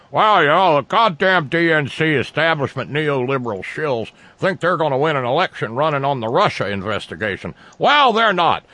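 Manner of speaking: high, loud mocking impression of critics; sarcastic mock voice. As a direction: sneer it like a smug pundit. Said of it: "I'm being a sarcastic jerk".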